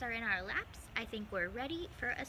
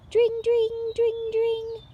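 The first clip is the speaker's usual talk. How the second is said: much higher, louder sing-song ding of a bell; bicycle bell; 13 semitones higher, 14 dB louder, much slower than usual. Acted. Mice ringing bicycle bells on the way, sung bell sound. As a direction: ring it out like a little bike bell.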